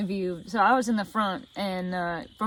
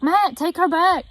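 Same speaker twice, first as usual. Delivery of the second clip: urgent order; 7 semitones higher, 5 dB louder, slower than usual. Retold or imitated. Mom urgently ordering dad.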